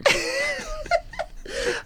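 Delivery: high squealing laugh, almost crying. Real, not acted. Teller laughs at his own story in a squealing laugh.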